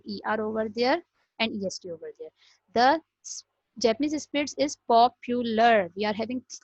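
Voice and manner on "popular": "popular" in three firm beats, pop - u - lar; split into syllables. Shown as a demonstration. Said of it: "three syllables here".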